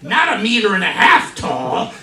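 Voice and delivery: high, loud incredulous shouting; hammed-up outraged protest; shocked. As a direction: rant in comic disbelief. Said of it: "And so I was shocked".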